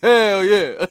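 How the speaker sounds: loud, high, laughing "Hell yeah"; Jax yell. Retold or imitated. Friend repeating the Jax line, loud through laughter. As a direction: shout it with a grin. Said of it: "He was like".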